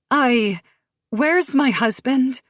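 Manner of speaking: high, slow stammer; stuttering. Acted. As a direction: stumble over the words. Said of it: "Amber stutters".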